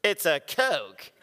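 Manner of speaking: slow incredulous line through a laugh; laughing retort. Retold or imitated. Husband dismissing wife's hurt feelings, slow and laughing. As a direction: scoff at it, laughing.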